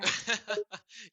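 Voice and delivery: loud high-pitched laugh; sudden loud high laugh of disbelief; burst of laughter. Real, not acted. Laughing at the chatbot for switching to Russian, loud high laugh.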